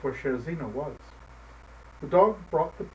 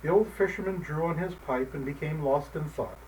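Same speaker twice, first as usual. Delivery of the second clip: usual speaking voice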